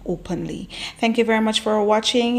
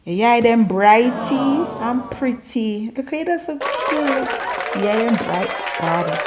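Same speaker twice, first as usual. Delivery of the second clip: slow, cooing Jamaican patois; sweet patois compliment; 3 semitones higher, slower than usual. Shown as a demonstration. Complimenting a cute person's eyes in cooing Jamaican patois. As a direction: coo over someone's pretty eyes in patois. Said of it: "We say".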